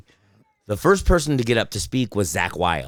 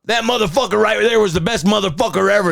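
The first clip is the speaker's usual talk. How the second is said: much higher, strained proclaiming voice; acted fired-up rocker's eulogy; impassioned eulogy; 11 semitones higher, 4 dB louder than usual. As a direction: belt out a rough tribute from the podium. Acted eulogy at rock funeral.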